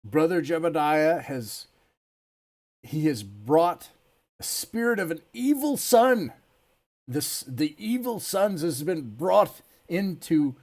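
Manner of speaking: slow, high, thundering denunciation; accusing elder. Acted. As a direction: preach the accusation to the crowd. Elder accusing before brotherhood.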